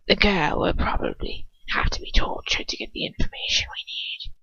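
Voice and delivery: cold hissing whisper of a dark lord; Voldemort whisper. Acted. Voldemort deciding who to send, in a cold whisper.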